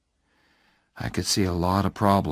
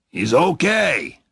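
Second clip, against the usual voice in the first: loud, higher, slow approving call; hearty verdict; 8 semitones higher, 6 dB louder than usual. A narrator's reading. Phil approves of Oliver, loud and hearty. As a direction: declare it gladly to the room.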